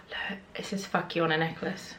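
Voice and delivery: whispered shocked look; hushed gasp. Real, not acted. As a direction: gasp and hush it.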